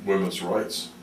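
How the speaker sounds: ordinary delivery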